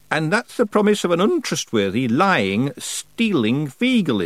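high, deliberate character voice; slow down on each damning word; accusing old witch. A narrator's reading. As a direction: witch pointedly questioning a rogue's promise. Miss Treason's character voice.

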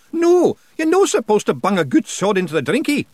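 a loud Scots yell; Rob yelling. Acted. Pictsie chief yelling at the boy who threw his sword away. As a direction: shout it out in the pictsie voice.

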